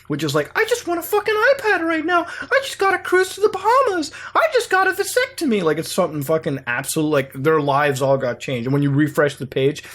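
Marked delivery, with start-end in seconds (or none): 0.6-5.6 very high squeaky put-on voice; mock over-excited online commenters way up high; fake gushing commenters. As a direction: parody fake testimonials in a silly squeak. Mimicking fake Facebook comments under ads.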